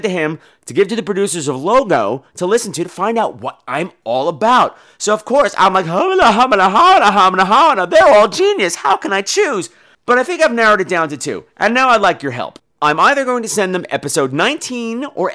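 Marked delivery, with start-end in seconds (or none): 5.8-9.7 higher, louder hammed-up fluster; mock gushing. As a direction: gush over your own brilliance. Gushing over his own episodes.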